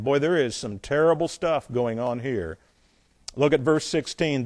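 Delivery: ordinary talk, nothing marked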